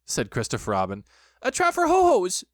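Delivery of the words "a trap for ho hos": high, loud squeaky outburst; flustered Piglet. A narrator's reading. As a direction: blurt it in a tiny agitated voice. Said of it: "said Piglet huskily".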